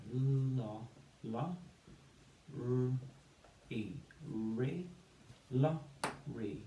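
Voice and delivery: LO and RE built sound by sound, first sounds held. Shown as a demonstration. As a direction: separate beats for LO and RE with gaps. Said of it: "use the syllables to build the word".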